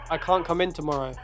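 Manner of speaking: ordinary talk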